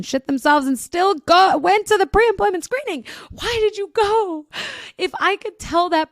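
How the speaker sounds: building to a high, loud squeal of disbelief; comic exasperation. Real, not acted. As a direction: wail it in amused disbelief, pitch climbing. Exasperated rant at the person's choice.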